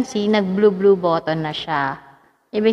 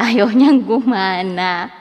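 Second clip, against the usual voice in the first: usual speaking voice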